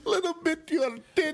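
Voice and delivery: high, fast, broken English; mock Czech accent. Mocking the producer's pitch, high and fast in a Czech accent. Repeating the impression, laughing.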